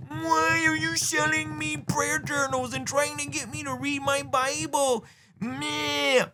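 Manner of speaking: high, slow, whiny goober voice; mocked complainers. Mocking goobers who object to merch sales, whiny voice. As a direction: drag it out in a high, silly whine. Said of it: "you're like".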